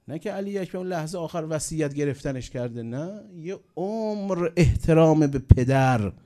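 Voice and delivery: high emphatic preaching. Real, not acted. Preacher stresses lesson to youth.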